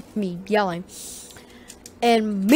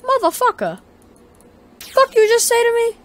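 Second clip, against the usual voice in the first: mock outraged retort; 13 semitones higher than usual. Retorting to insulting lady.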